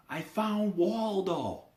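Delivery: high, loud, drawn-out call; slow teasing yell. Retold or imitated. Teasing cousin Terry across the brush, slow high yell. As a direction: stretch it out to tease him from afar. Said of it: "And I yelled over to him".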